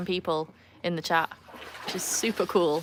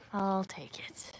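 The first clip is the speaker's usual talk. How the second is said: slow pleased whisper; 7 dB quieter, much slower than usual. Shown as a demonstration. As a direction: repeat it in a breathy whisper, slow. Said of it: "Nick says I sound posh".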